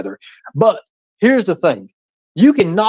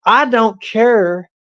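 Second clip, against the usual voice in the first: mocking imitation; 6 semitones higher, slower than usual. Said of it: "plainly says on her live streams".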